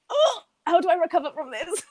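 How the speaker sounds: high, flustered mock dismay; acted shock. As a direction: play stunned and lost for words, half laughing. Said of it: "and you're like".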